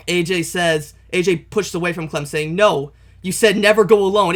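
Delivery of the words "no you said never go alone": raised protest. Acted. A J pushes away from Clem protesting.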